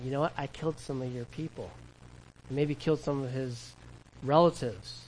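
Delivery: soft, low confession with halting pauses; imagined tearful confession. Acted. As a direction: confess it quietly, as if close to tears.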